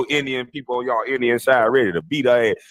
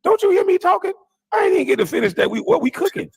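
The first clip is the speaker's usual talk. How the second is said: high-pitched mock outrage; exasperated, much higher voice; indignant comeback; faster than usual. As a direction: act out telling off an interrupter. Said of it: "she would have said that in my face".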